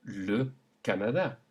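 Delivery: le Canada in French; French plain E. Shown as a demonstration. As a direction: model the le. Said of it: "normally pronounced E".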